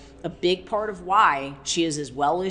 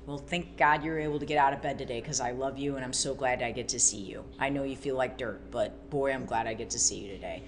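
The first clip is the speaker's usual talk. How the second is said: gentle encouragement; 5 semitones lower, 7 dB quieter, faster than usual. Acted. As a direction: lower and softer, tender reassurance. Encouraging a struggling family member.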